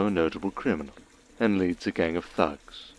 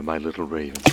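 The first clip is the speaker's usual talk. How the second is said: ordinary delivery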